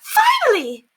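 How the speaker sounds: very high, loud cry of relief; shriek. Acted. Lady shrieks as the letter drops in. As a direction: shriek it out at the top. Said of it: "Lady Constance shrieked".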